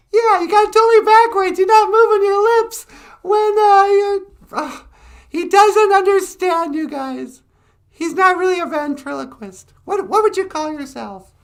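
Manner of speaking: very high, loud squeaky puppet. Acted. Puppet Natasha mocking the ventriloquist, very high and loud. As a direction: tease him in a squeal.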